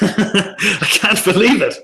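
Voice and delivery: high, drawn-out words through real laughter; laughing disbelief. Real, not acted. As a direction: laugh it out, amazed and slow.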